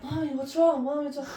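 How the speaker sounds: put-on worried child. Worried son asks his mom what's wrong. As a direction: voice her worried little son. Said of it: "Grayson's like".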